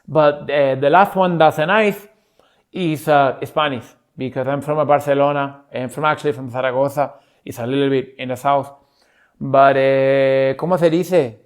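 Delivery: Castilian accent with a lisp; Spanish accent. Shown as a demonstration. Claiming to be from Zaragoza, Spanish accent. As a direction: play a Spaniard groping for English. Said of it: "when I'm doing this one".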